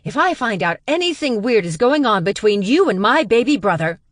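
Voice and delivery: high, loud parting threats; yelling. Acted. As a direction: keep shouting from the doorway. Still yelling while dragged out.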